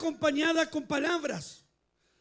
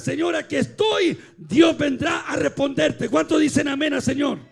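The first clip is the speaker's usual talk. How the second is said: loud, sustained sermon climax; full-force preaching; 8 dB louder than usual. Real, not acted. (predicación a plena voz)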